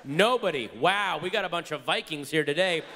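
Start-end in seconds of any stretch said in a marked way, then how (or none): none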